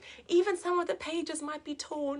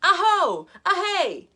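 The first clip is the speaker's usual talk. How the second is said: excited call; 6 semitones higher, 6 dB louder than usual. Acted. Child calls out in wood.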